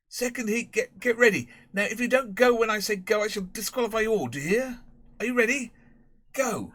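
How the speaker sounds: high, loud, exasperated starter's calls; a master bellowing orders at a race; shouting schoolmaster. Acted. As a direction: Prendergast shouting at the boys to start the second heat.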